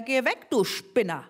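a touch of an angry driver; the driver telling Mortimer to go away; mild mock snarl. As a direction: brush him off rudely. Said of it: "brüllte er".

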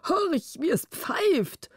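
high, drawn-out marvelling; enraptured king. A narrator's reading. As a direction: play a king entranced by birdsong, slow and high.

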